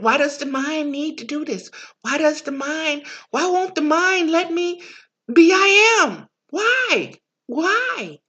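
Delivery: anxious mind begging to know; frantic questioning. Acted.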